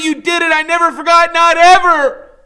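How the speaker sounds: highest, loudest shriek, slowing on not ever; a boy screaming he never forgot. Acted. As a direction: scream until the voice breaks. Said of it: "so all I can do is keep screaming".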